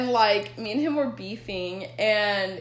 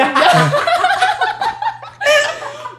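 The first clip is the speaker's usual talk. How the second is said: bursting into loud high laughter; 10 dB louder than usual. Real, not acted. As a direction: cackle out loud at yourself. Friends laughing at own confession.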